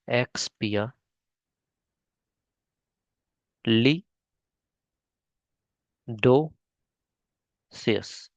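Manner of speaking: very slow, the last part drawn out; broken into syllables. Shown as a demonstration. Teacher breaks the long word into pieces for spelling, drawn out. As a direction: stretch each chunk for dictation. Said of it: "मतलब तोड़ तोड़ के".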